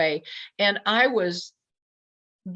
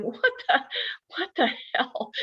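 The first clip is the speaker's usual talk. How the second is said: higher, halting mock bewilderment; retold disbelief; 9 semitones higher than usual. Teller looking at that thing, bewildered. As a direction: replay being dumbfounded, pitched up. Acts out own shocked reaction.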